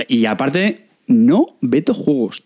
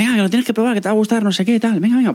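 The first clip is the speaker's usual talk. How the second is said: higher pushy nagging; nagging friend; 8 semitones higher, faster than usual. Retold or imitated. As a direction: pester like an eager friend. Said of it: "dándole la paliza".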